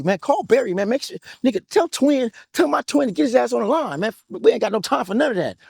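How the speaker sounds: high, urgent, pumped-up orders to a friend; hyped-up retold order. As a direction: fire him up, no time to waste. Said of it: "I'm always hyped".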